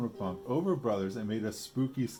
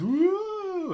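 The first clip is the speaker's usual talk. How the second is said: long high ghostly woo; spooky wail; 10 dB louder than usual. A narrator's reading. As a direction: wail to frighten her. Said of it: "A really spooky wailing noise".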